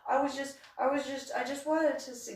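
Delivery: high, stammered mock defense; acted flustered little voice; sheepish excuse. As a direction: whine it like you've been caught.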